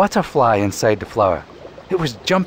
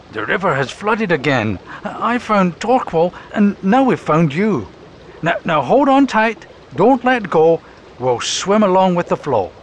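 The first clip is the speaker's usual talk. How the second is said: higher, steady rescuer voice; Burton; 7 semitones higher, 3 dB louder than usual. Acted. Burton telling the robin to hold on, steady and firm. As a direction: reassure and instruct firmly. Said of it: "Burton told the robin".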